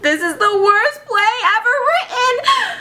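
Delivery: high, drawn, theatrical exclamation; acted elation. Max finding the worst script, acted elation. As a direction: proclaim the awful find in a high voice.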